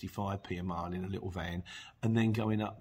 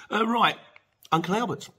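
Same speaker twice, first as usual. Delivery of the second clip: announcing; 11 semitones higher, 8 dB louder than usual. Real, not acted. Announcing the next segment.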